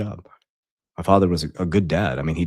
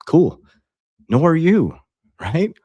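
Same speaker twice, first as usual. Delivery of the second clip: defiant shrug; 10 semitones higher, 6 dB louder, slower than usual. Real, not acted. Defiant about lost followers.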